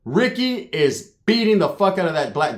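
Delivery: ordinary talk, nothing marked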